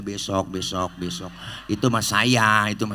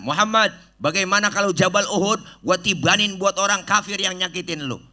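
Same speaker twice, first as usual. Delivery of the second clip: angry angel; 9 semitones higher, 4 dB louder than usual. Acted. Angel Jibril offers to punish.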